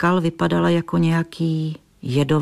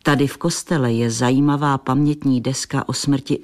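ordinary delivery, nothing marked

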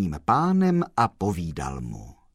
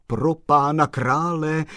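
exclaiming; 7 semitones higher, 6 dB louder than usual. A narrator's reading. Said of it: "zvolal pan Kolbaba".

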